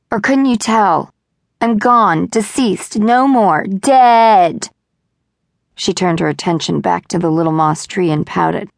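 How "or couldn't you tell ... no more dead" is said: rising, louder melodrama building to a cry of dead; melodramatic. A narrator's reading. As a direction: ham up the tragedy, louder each word. Melodramatic announcement to the sky.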